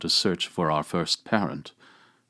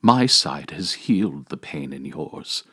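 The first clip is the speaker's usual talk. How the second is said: normal talking voice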